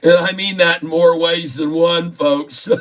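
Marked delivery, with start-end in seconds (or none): none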